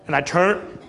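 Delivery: ordinary talk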